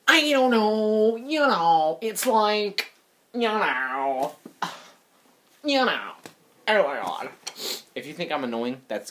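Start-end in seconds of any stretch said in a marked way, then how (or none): 0.1-1.2 slow, higher, drawn-out whiny parody; mock annoying talker with stretched vowels. Shown as a demonstration. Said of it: "'cause that's an annoying voice". 1.2-6.1 drawn-out mock filler talk; annoying voice. Shown as a demonstration. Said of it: "'cause that's an annoying voice".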